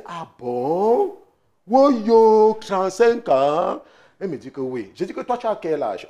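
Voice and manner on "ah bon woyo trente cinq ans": drawn-out amazed question; mock astonishment. Pastor acting his amazement at thirty-five years of marriage. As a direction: stretch trente-cinq ans in disbelief.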